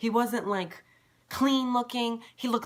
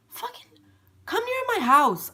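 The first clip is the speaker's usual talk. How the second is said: much higher and louder, incredulous; a high, loud, exasperated rant; indignant outburst; 6 dB louder than usual. Real, not acted. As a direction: fling it at him, outraged.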